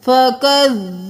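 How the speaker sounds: "फ़कज़्ज़बूहुमा" with the zaa held double; doubled zaa. Shown as a demonstration. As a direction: press the shadda on "फ़कज़्ज़बूहुमा". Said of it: "ज़ा दो बार तशदीद है".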